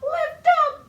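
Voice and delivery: high, loud squeal of lift up; excited lift. Shown as a demonstration. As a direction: leap up on the words as the baby flies. Lifting babies up in rhyme.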